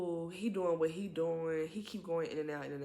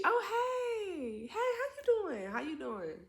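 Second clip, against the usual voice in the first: high, sing-song mock flirty voice with a laugh; flirty woman greeting. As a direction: mimic her sugary hello, much higher. Woman at car window greeting flirtily.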